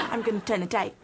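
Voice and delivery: gasp, then a jokey South African line; excited South African guard; comic South African accent, eager. Acted. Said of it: "South African accent".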